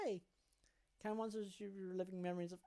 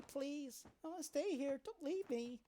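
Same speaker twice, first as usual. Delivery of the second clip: put-on pleading child; 8 semitones higher than usual. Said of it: "Her cries mingle with tears".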